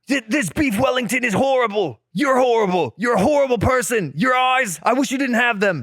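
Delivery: high, shouted mock-furious chef tirade; hammed-up screaming chef berating a cook; squeaky furious TV chef; Gordon Ramsay rant. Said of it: "Pretend you're him for a second".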